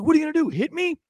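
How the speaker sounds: high, goading imitation of the victim; mock challenge. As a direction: dare the officer in a high put-on voice. Retold victim challenging officer.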